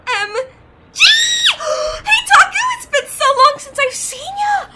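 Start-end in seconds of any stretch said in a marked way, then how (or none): 1.1-1.5 sharp, very high delighted gasp; excited gasp. Acted. Spotting her old friend Taku, a high delighted gasp. 1.6-4.7 excited reunion. Acted. Greets old friend after years.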